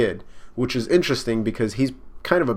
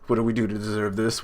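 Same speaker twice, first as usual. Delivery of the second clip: ordinary talk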